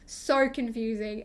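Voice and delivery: usual speaking voice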